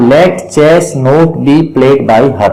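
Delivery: very slow, word by word; slow dictation. Shown as a demonstration. Teacher reading the passive answer slowly to students. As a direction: spell it out slowly for the class. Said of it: "teacher dictating the answer".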